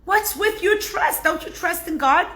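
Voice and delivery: wife chiding him about trust; scolding wife. Retold or imitated. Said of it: "The wife goes".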